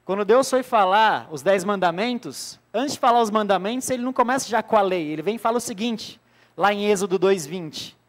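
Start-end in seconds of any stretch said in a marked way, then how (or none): none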